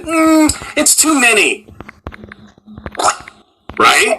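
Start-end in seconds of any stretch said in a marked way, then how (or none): 0.0-1.7 high, slow, dismissive "it's too many"; mock producer dismissal. Producers cutting the seventh friend, dismissive mock voice. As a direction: brush it off like a casual producer. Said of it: "they just said".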